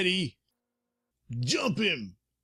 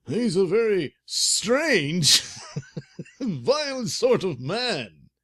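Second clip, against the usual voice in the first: slow, louder worried neighbor; nervous neighbor; 7 semitones higher, 6 dB louder, slower than usual. Acted. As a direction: stress it like a jittery tenant. Neighbor man speaks.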